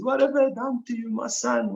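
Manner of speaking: high, frantic wail of a worried parent; imitating a frantic mother, pitched high; distraught Italian mother. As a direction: cry it out like a panicked mother. Family panicking over wounded son.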